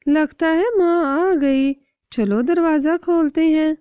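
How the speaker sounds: loud, high happy chick cries; excited chicks. Acted. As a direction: shout eagerly that mum is back.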